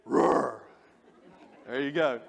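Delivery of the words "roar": put-on a low, half-serious roar like an old lion. Shown as a demonstration. Preacher showing the congregation the old lion's roar, low mock roar. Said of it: "I'm gonna do it".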